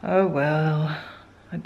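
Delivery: ordinary talk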